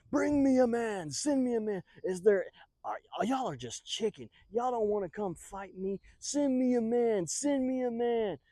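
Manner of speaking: loud, high taunting bellow; giant's yell. A narrator's reading. Giant challenges the army, yelling. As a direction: bellow a challenge, stretching the words. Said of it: "he hears the giant yell".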